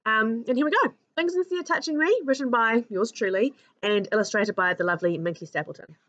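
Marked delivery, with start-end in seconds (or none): none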